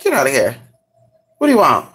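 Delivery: ordinary talk, nothing marked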